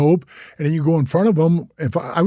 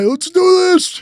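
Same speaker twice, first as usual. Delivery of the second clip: pumped-up battle cry; 4 dB louder than usual. Retold or imitated. Imitating actors getting pumped for the show, hyped-up cry. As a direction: mimic someone psyching up for action. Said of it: "Get all high".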